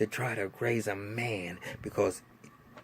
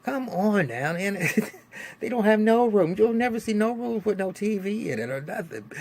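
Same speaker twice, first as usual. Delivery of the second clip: higher and louder, in disbelief at houses with no TVs; exasperated outcry; 11 semitones higher, 3 dB louder than usual. Real, not acted. Vlogger ranting to viewers about a show, exasperated and loud. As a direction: throw your hands up at the TV-less houses. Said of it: "exasperated rant about no TVs".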